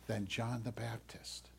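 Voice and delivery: soft reverent whisper; awed whisper. Acted. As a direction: drop to a breath for the reveal. Quoting Bible, dramatic hush.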